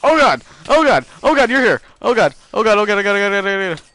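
panicked high shrieking; terrified gibbering. Real, not acted. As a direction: scream it in sheer panic. Ambushed by a mob.

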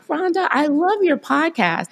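high, bright imitation of a young fan; gushing young fan. Retelling the friend's car compliment, high gushing mimic. Quoting daughter's friend's praise.

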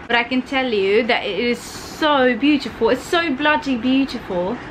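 put-on British accent. Vlogger raving about a British show, in a put-on British accent. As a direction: gush in a mock British accent. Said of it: "I can't stop this accent".

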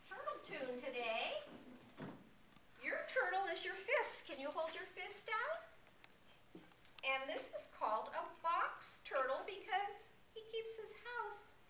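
usual speaking voice

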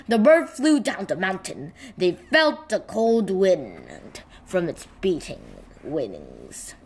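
ordinary delivery

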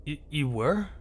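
soft, stuttering surprise; nervous stutter. Acted. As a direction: trip over the first word nervously.

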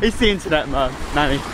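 ordinary delivery

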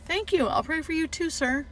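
high, soft, sweet thanks; tender goodbye. Retold or imitated. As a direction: gently thank the patient on leaving. Nurse's tender goodbye to patient.

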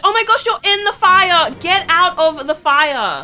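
frantic scolding at full volume; alarmed yelling. Real, not acted. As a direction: yell at them in a panic. Characters burning in the fire.

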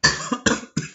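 short dry throat cough; a real dry cough from dry air. Real, not acted. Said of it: "desculpa a tosse aí".

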